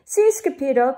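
breathless little pant. A narrator's reading. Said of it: "panted Poquito Tito".

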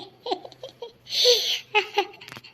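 high gloating cackle, loud; mock cackling laugh. Mother-in-law cackles at the fallen bride, high and loud. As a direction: cackle with glee at her trouble.

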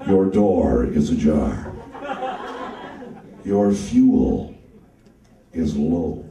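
very low, slow butler voice; slow, deep English butler; Alfred the butler. Acted. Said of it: "he did sound like the butler from Batman".

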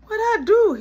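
high, loud what-did-I-do; indignant protest. Retold or imitated. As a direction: protest your innocence. Kid protesting innocence.

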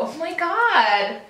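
high, drawn-out mock American; American accent. Making a friend understand, high put-on American accent. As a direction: exaggerate a squealy American accent. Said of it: "put on my American accent".

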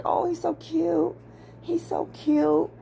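put-on high, gushing baby-talk coo; squealing at a puppy photo, high and gushing; cooing over a puppy. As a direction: melt over the cute puppy.

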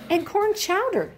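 put-on high, bright little-girl Stella. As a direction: pipe it up like an eager child. Little Stella answering mama.

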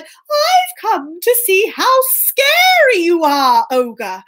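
high, slow, bold cat voice; plucky storybook cat facing an ogre; brave Puss. Acted. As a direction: put on a high voice and declare it grandly. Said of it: "but knew he had to be strong".

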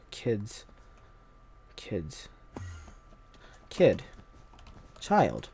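breathy, fed-up sigh then a soft groaned word; quiet weary disdain; exasperated sigh. Real, not acted. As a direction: give up on them with a sigh. Exasperated at other players.

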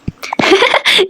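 high giggle to oneself; amused, high-pitched burst of laughter; laughing. Acted. Wife giggling at her phone beside husband, amused laugh. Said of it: "kime gülüyorsun ya".

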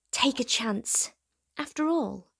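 usual speaking voice

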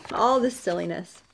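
usual speaking voice

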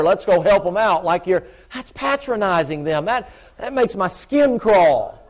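loud, high, driving preaching; preaching at full force. Acted. Preacher to the congregation about kids in jail, loud fervent preaching. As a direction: lay it on the church, full revival fervour.

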